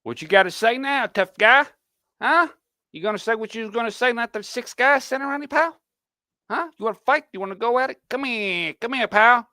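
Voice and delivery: hammed-up scrappy challenger egging on a fight; mock tough-guy taunting. Mocking Adam squaring up to Kansas, hammed-up brawler taunt.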